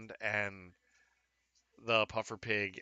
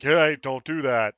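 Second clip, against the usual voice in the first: loud, firm warding-off call; mock raptor-trainer command; 3 semitones higher, 13 dB louder than usual. Game master mimics Owen Grady holding off Velociraptors, loud command. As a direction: call out like steadying an animal.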